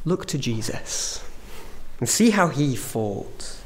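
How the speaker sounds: normal talking voice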